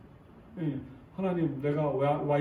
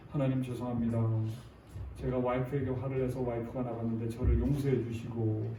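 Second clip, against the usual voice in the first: stiff contrite prayer; 6 semitones lower, 4 dB quieter than usual. Pretend. Stiff apologetic prayer example.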